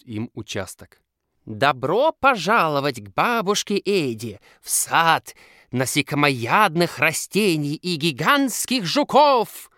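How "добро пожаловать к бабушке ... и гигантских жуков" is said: high, loud, slow spooky voice; creepypasta narrator. Acted. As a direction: spook the kids with a ghoulish welcome. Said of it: "Жутким голосом приветствует их Наташа".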